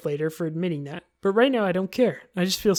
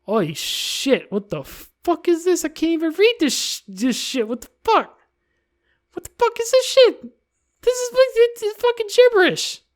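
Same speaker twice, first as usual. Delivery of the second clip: much higher and louder, building outrage; outraged disbelief; 12 semitones higher, 4 dB louder than usual. Real, not acted. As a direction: shriek in disbelief at the poem. Reacting to gibberish poem.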